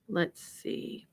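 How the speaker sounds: ordinary talk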